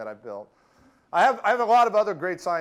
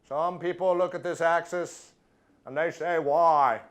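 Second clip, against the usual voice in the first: measured political-speech impression with deliberate pauses; Bobby Kennedy; slower than usual. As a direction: imitate a famous politician's oratory. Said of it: "My impression of Bobby Kennedy".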